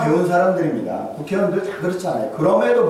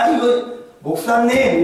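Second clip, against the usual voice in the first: pointed call; 7 semitones higher, slower than usual. Retold or imitated. Wife pulls rank.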